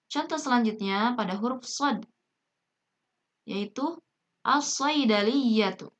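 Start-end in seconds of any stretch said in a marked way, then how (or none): 4.4-5.9 slow "as-saydaliyatu" with a heavy doubled S; silent lam. Shown as a demonstration. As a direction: read "as-saydaliyatu" deliberately, lam merged into shad. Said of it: "lamnya tidak dibaca jelas".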